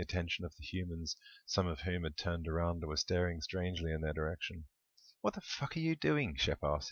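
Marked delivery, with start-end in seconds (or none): 5.2-6.4 high-pitched exasperated demand; thoroughly irritated, high voice. A narrator's reading. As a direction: ask it in rising irritation, pitched well up. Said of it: "his irritation in no way abated".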